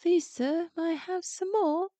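high, pleading little orphan voice; hungry little boy. Retold or imitated. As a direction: beg like a starving small boy. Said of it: "hungry little boy is like".

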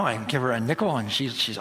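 usual speaking voice

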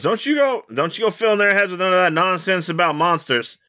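high-pitched fussing mother; scolding mama. Acted. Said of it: "And her mama goes".

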